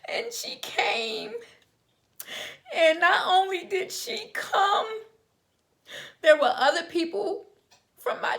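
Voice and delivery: fighting back tears as she says it; crying. Real, not acted. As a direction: voice breaking, high and tearful. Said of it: "I'm trying not to cry, y'all".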